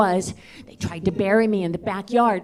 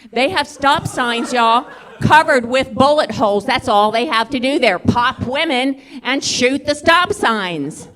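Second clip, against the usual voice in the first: slow, loud, incredulous proclamation; loud comic rant; 2 semitones higher, 5 dB louder, slower than usual. Real, not acted. Comic telling the crowd about Alaska, loud exasperated rant. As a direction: belt out each phrase slowly.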